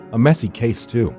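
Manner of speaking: ordinary delivery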